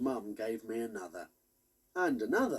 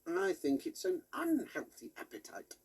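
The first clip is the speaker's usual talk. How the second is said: a squeaky little toy voice, whispered; lightly voiced squeaky toy pig; funny squeaky voice; 8 semitones higher than usual. A narrator's reading. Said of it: "said a funny squeaky voice".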